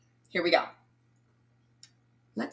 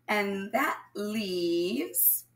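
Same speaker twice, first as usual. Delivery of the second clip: drawn-out suspense; much slower than usual. Acted. Suspense before the answer.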